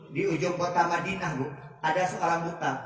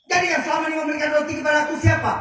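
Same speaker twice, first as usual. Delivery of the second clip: startled shout; 10 semitones higher, 4 dB louder, much faster than usual. Acted. Blind man demands who fed him.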